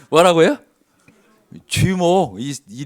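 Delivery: a laughing, startled what-did-you-say; laughing disbelief. Retold or imitated. Reacting to God's answer.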